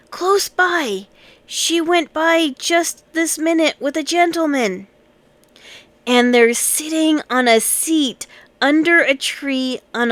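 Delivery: lightly voiced child, indignant and thrilled; excited child. A narrator's reading. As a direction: tell it like a breathless kid with news. Child tells what she saw.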